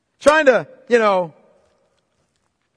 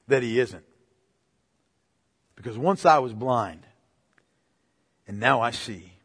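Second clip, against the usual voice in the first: hushed; 11 semitones lower, 6 dB quieter than usual. Real, not acted. Preacher drops his voice.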